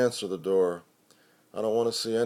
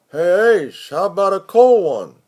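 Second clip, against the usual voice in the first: higher and louder, shouted up from downstairs; calling out; 9 semitones higher, 10 dB louder than usual. Acted. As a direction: hail him across the yard. Roomie calls out from downstairs.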